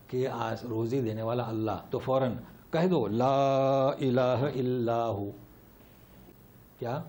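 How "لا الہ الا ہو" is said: slow, drawn-out chanted recitation; Quranic recitation. Shown as a demonstration. Teacher recites the answer to the class, slow chant. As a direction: intone each word slowly like tilawat.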